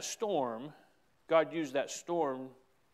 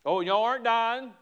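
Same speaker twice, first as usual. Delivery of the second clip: Southern paraphrase; 7 semitones higher, 6 dB louder than usual. Retold or imitated. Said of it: "didn't have the southern accent I have".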